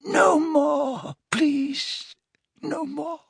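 high, thin tearful wail; tormented high-pitched begging; agonized cry. A narrator's reading. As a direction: wail for mercy, fading at the end. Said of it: "he yelled again".

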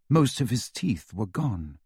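ordinary delivery, nothing marked

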